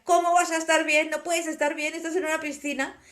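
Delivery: re-live pleading through tears with the dolphin; retold crying plea. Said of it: "Y yo lloraba".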